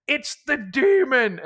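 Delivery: loud, high, slow anguished cry; screaming out. Retold or imitated. Dane on his knees in pain: loud slow scream. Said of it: "he screams out".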